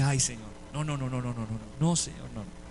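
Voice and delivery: hushed, low string of refusals; remorseful pleading. Retold or imitated. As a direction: plead under the breath, fading away. Ruega arrepentido al Señor. (súplica arrepentida en voz baja)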